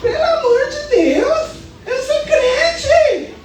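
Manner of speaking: high, laughing, half-crying plea; hammed-up alarm with a giggle; laughing panic. Wife retelling the storm to the church, laughing mock panic.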